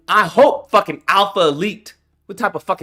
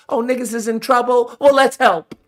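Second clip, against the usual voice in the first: higher, put-on voice dripping sarcasm; sarcastic mock voice; 5 semitones higher than usual. Vlogger mockingly voices a helpful country, sarcastic. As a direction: fake the helper's eager tone.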